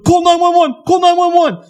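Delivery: loud, very high mock panic; panicked yell. Kid runs back in after blast, panicked high yell. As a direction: imitate a frantic kid yelling for help at the top of the voice.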